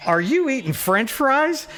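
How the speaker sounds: high, slow mock disbelief; incredulous driver. Driver asks, quoted.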